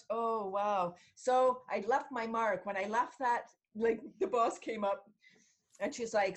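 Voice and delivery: usual speaking voice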